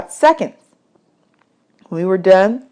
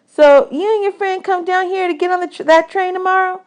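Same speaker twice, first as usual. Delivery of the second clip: put-on much higher, bossy little girl; 12 semitones higher than usual. Desi quizzing new boys about dishes, high bossy girl voice. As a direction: boss the new boys around.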